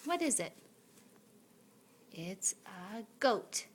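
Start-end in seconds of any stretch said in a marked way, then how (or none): 2.1-3.7 slow and clear. Shown as a demonstration. Slow teaching.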